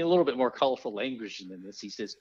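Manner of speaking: ordinary talk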